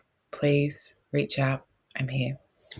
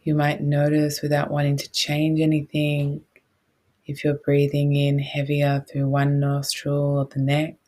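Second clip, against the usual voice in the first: guided meditation; 4 dB louder than usual. Real, not acted.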